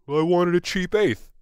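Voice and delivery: lightly voiced stoner uncle; a hint of a sheepish stoned uncle making excuses. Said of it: "Your Uncle Gail sounds like".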